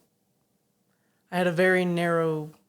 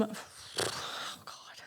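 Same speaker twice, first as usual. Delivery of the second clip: whisper; 2 semitones higher, 14 dB quieter than usual. Real, not acted. Player stalling, whispering.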